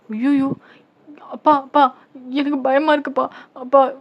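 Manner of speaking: high, loud panicky cries for dad; tearful, frightened. Acted. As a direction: call for your father in acted fear. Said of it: "அப்படின்னு சொல்லிட்டு நீங்க அழுவுறீங்க".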